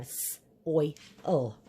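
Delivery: "s-, oil" split after a held s; sounded out. Shown as a demonstration. Teacher finding oil in soil, s sounded apart from oil. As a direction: isolate the s, then say oil. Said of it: "I hear oil right in that word".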